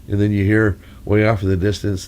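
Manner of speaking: normal talking voice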